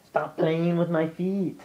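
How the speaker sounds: high, slow, mock-stern at the cat; playful scold. Real, not acted. Telling her cat off for playing with her feet, high slow playful. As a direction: tease the cat to stop, drawn out.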